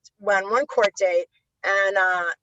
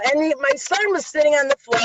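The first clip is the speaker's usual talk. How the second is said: usual speaking voice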